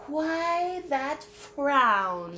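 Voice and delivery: high, slow snake voice. Acted. Reading to kids, Snake asks Jim about his frown. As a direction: draw it out like a snake.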